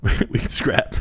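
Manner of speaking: breathy laugh through the words. Real, not acted. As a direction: laugh it out while repeating. Echoing cohost's challenge.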